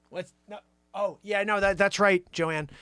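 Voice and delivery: normal talking voice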